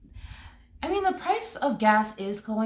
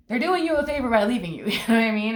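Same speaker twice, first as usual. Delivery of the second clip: louder, higher burst that breaks into a laugh; excited, laughing; 5 semitones higher, 7 dB louder, faster than usual. Real, not acted. Tarot reader tells the sitter the breakup is a favor, excited and laughing. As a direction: blurt the punchline and laugh at it. Emphatic point, then laughing.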